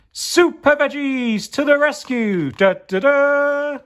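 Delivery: very high, loud "Da, da, da" fanfare; heroic fanfare. Acted. Super Veggies arriving, loud high fanfare. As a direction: trumpet their arrival. Heroes' grand entrance.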